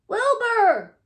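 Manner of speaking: loud call, pitched high; shouting Wilbur. A narrator's reading. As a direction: call him through the door. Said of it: "shouted Hector".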